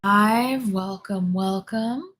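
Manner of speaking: slow, loud greeting; drawn-out welcome. Real, not acted. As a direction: stretch it out like a host. Streamer greets viewers.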